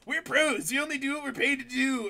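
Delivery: high, loud parody of a catchphrase; over-the-top imitation of self-styled professionals; mock self-important boast. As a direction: blurt it proudly, hammed-up. Mock retelling of characters' catchphrase.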